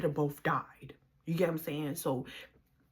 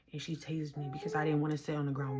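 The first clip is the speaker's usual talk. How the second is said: ordinary talk, nothing marked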